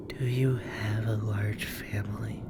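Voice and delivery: eerie breathy whisper; creepy spirit whispering closely; raspy whisper. Acted. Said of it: "Her voice sounded like wood splintering".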